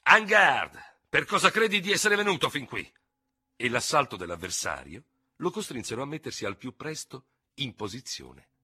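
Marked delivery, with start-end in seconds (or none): 0.0-2.9 high, loud fierce taunt; ferocious challenge. Acted. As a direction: attack him with the words. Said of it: "un feroce". (sfida feroce, acuta e forte)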